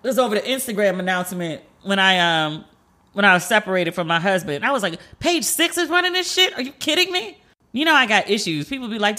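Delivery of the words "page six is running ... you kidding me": high-pitched, indignant disbelief; retold incredulity. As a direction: replay your disbelief in a much higher voice. Retold outraged disbelief at gossip page.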